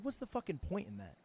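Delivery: ordinary talk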